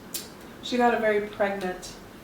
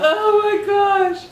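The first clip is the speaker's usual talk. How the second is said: surprised; 10 semitones higher, 6 dB louder, much slower than usual. Real, not acted. Shocked reaction to mouthful.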